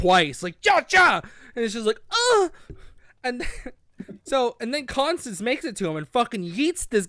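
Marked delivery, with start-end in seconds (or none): 0.6-1.2 two loud, high stab sound effects; imitated quick stabs, sharp and loud; mock stabbing noises. 2.1-2.5 high-pitched "ugh" of a stabbed victim; cartoonish high grunt of pain; mock groan of pain.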